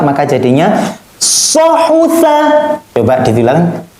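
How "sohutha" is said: sohutha recited high, drawn and firm; fast recitation. Shown as a demonstration. Teacher shows the fast, firm chanted reading of sohutha. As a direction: chant sohutha firmly, as a quick tajwid reading. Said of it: "Ketika baca cepat".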